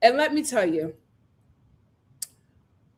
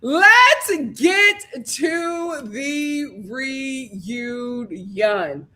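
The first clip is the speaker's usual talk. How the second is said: slow drawn-out; much slower than usual. Real, not acted. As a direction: stretch every word very slowly.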